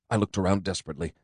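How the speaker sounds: ordinary delivery, nothing marked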